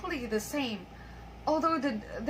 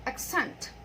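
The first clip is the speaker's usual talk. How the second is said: "Accent" stressed on its second syllable; light first syllable in "Accent", weight on the last; "Accent" as a verb, falling on the stressed ending. Shown as a demonstration. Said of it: "stress is in the second syllable".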